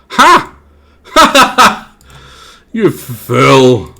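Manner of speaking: cackling villain jeering; mocking character laugh. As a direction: gloat over the fool with a loud cackle.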